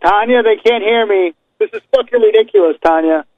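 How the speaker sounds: high, annoyed aside to his assistant; frustrated Ric Flair. Acted. Ric Flair griping to Tanya that the caller can't hear. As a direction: grumble that the fan can't hear. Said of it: "[name], you be Ric Flair".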